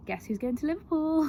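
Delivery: high, loud and bursting with news; excited. Retold or imitated. Daughter bursting into the living room to tell her mum, excitedly. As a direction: announce it like a thrilled daughter.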